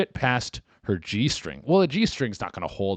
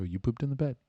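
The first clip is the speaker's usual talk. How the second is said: soft, patient confirmation; gentle boyfriend; 3 semitones higher, 4 dB quieter than usual. Acted. Boyfriend confirms the news to girlfriend, gently. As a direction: repeat it kindly and quietly. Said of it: "You go".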